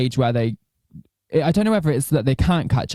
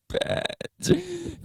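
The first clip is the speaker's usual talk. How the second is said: playful squeal; 8 dB quieter than usual. Real, not acted. Teasing friend after a burp.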